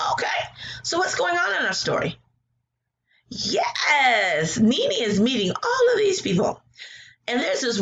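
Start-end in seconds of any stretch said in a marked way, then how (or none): none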